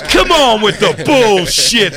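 high, slow mock complaint; drawn-out disgusted moviegoer; guy storming out. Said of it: "He goes".

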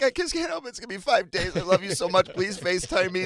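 dad pretending to cry at his daughter's goodbye, mock sobbing; hammed-up fake crying. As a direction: overdo a pretend tearful goodbye. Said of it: "doing our fake crying".

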